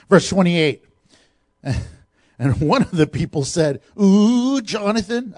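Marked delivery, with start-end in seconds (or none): 4.0-5.3 a mock teasing ooh from the crowd, higher; playful ooh, teasing Jonathan. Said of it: "I added that".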